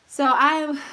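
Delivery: breathless from setting up; winded, short of breath. Real, not acted. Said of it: "see, I'm out of breath".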